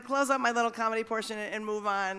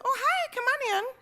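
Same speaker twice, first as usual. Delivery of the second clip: much higher, bright welcoming lady voice; chirpy neighbor woman; 5 dB louder than usual. Retold or imitated. Woman at the door greets a canvasser, high and welcoming. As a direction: play the friendly woman opening her door. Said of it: "and a woman says".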